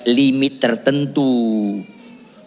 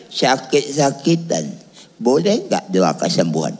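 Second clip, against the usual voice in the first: put-on elderly retired pastor. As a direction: imitate an ailing old pastor asking. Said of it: "ada pendeta pensiun emeritus".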